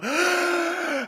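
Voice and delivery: high sharp gasp for air; out-of-breath gasp. Real, not acted. After droning on and on, gasping for breath. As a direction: gasp after the long ranting. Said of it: "Out of breath".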